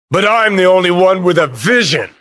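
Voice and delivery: high, impassioned self-defence; heated insistence. Acted. Defending himself as the one with vision, heated. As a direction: insist on it, pitch well up. Acted quarrel, self-justifying.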